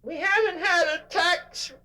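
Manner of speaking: high, whiny mock complainer voice; complaining employee. Speaker mocks an employee complaining about his job, in a high whiny mock voice. Mocking whining complainer voice continues.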